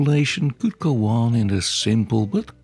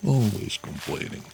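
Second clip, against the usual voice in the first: softer grumbling barbarian; 3 semitones lower, 4 dB quieter than usual. Acted. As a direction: grumble off muttering about women. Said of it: "barbarian grumbles about women".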